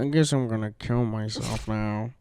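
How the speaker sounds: a defeated comic after the booker praises a bombed set; deadpan cartoon despair. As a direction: slowly give up on everything in a cartoon voice. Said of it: "reminds me of Smiling Friends".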